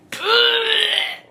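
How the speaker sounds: high, sudden shriek of cringe; cringing shriek. Real, not acted. Reader cringing at the man's dessert talk, shrieking. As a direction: shriek cutting off your own quote.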